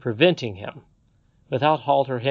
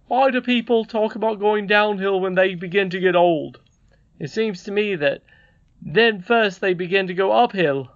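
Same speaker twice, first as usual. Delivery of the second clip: higher, emphatic storybook father; musing father figure; 7 semitones higher, 6 dB louder than usual. Acted. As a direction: put the question earnestly in a raised voice. Voices Peter in story.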